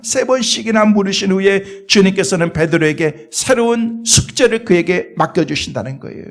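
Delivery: ordinary delivery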